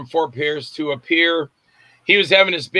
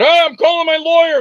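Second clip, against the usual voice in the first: much higher and louder, alarmed; panicked bystander; 12 semitones higher, 7 dB louder than usual. Retold or imitated. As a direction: squeal in mock panic about the lawyer. Crowd panics at fake arrest.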